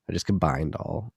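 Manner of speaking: normal talking voice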